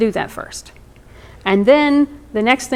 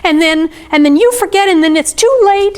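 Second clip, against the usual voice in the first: mock childish distress, pitched way up; anxious six-year-old; 10 semitones higher than usual. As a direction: imitate a little girl getting more and more worked up. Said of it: "she's like".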